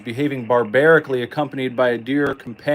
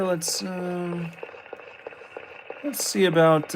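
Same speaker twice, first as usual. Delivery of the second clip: ordinary delivery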